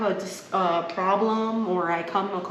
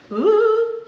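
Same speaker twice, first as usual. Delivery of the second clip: mock wail; 13 semitones higher, 6 dB louder than usual. Imitating crying in shower.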